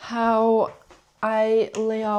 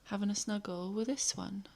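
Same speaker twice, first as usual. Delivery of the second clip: soft hushed whisper; 10 dB quieter than usual. Real, not acted. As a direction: whisper so the pet stays calm. Whispering beside snuggled pet.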